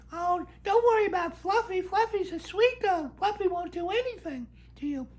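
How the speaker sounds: squeaky mock voice of the neighbour waving off his worry about Fluffy; high mock dog lady. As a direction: play the dog lady high and shrill, brushing him off. Woman dismissing his worry.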